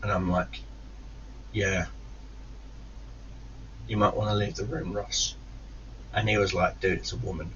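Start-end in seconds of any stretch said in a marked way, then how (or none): 1.5-5.4 soft, restrained warning; quiet menace. Retold or imitated. Retold cold menace to brother. 6.8-7.5 soft, low retold objection; quiet caution. Brother quietly cautions him.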